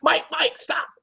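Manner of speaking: high urgent plea to stop him; urgent call. Retold or imitated. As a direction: call out his name to hold him back. Stopping friend from hitting someone.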